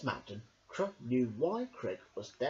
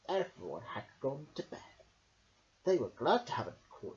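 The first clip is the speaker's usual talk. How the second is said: plain reading voice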